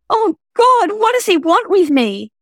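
high, loud, desperate cry of fear; a trapped woman's panic breaking through; panicked. Acted. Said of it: "'cause she's panicked".

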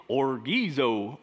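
put-on orgizo in a drawn-out Southern accent. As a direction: imitate a Southern guy saying orgizo. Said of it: "is that the Southern accent saying".

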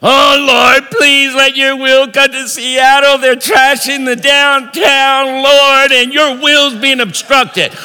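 high, wailing parody of prayer; hammed-up plea held on a high note; mock pleading prayer. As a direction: imitate a fretful believer begging God. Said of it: "it did not say".

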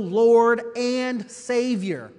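slow, weighty emphasis on "and"; stress on "and". Shown as a demonstration. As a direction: lean long and hard on the word "and". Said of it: "Emphasis on the and".

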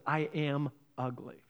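slow, low and very soft; quiet acted despair; dejected. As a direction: sink into hushed sadness. Sad duckling sees his reflection.

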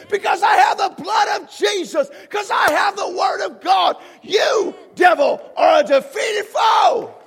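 loud, high declarations building to the end; preaching at full force. Acted. Pastor declaring victory over the devil, full force. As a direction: proclaim it with full authority.

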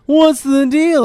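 high, loud stand-up catchphrase; Jerry Seinfeld. Retold or imitated. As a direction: punch it like a sitcom comic. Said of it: "when he does his".

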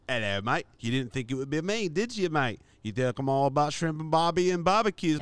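broad mock Australian accent; Australian devil. Devil surprises his mate, hammed-up Australian accent. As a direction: play a hammed-up Aussie devil. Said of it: "The devil's Australian".

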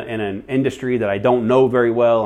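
ordinary talk, nothing marked

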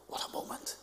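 hushed unvoiced whisper; awed whisper. Real, not acted. Preacher whispering in awe to the congregation. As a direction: breathe it out in awe.

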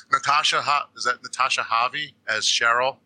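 ordinary talk, nothing marked

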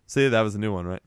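ordinary delivery

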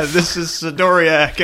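loud, slow hammed-up French accent; mock French. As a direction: drag out the name like a parody. Mocking radio call sign.

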